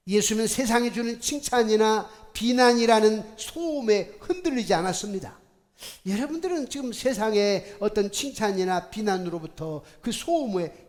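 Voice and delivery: loud, driving pulpit delivery; forceful preaching. Real, not acted. Pastor urging the congregation, loud preaching. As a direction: preach it hard to the pews.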